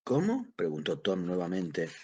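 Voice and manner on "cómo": high, sharply rising query; irritated, hard-of-hearing what?; somewhat angry. A narrator's reading. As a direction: ask twice, the second much higher. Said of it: "un tanto encolerizado".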